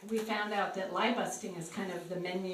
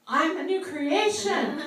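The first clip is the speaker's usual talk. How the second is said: higher, louder and slow, beaming; slow, bright, raised declaration; joyful proclamation; 9 semitones higher, 8 dB louder, slower than usual. Retold or imitated. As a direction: proclaim your new life. Woman proclaims after prayer.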